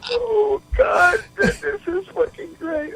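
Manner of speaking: high, soft and slow, crying with laughter; barely getting words out through tears of laughter; real tearful laughter; laughing to tears. Real, not acted. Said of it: "here in tears with so much fucking laughter".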